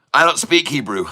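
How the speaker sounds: ordinary talk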